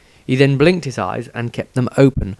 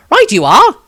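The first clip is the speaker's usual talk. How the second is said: bright, much higher, louder exclamation; cheery reply; 9 dB louder than usual. Acted. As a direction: toss it off with cheerful gusto.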